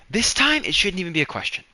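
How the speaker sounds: high, fast and pressing; heated insistence. Real, not acted. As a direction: push the point hard, rushing it out. Arguing heatedly with coworker Bree.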